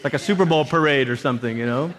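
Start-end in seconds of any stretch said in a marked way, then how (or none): none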